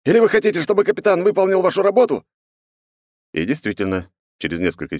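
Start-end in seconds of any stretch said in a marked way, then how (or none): 0.0-2.2 loud, high-pitched scolding question; barked rebuke. Acted. Грам shaming the idle crew, barking. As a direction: holler it as a threat, voice held high throughout. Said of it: "гаркнул пришедший в себя Грам".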